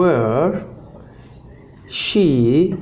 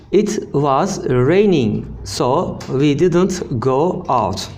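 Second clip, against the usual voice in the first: slow, carefully spaced teaching read; slow model reading; slower than usual. Shown as a demonstration. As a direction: spell the sentence out slowly for learners. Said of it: "teacher models sentence slowly".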